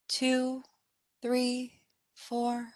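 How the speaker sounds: soft, evenly spaced count held up in pitch; inhale count. Acted. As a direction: count them gently through breathing in. Counting the in-breath.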